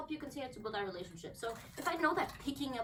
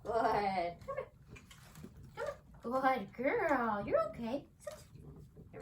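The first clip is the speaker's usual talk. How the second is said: usual speaking voice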